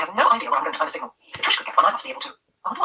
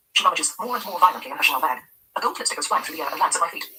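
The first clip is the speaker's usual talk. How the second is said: ordinary delivery, nothing marked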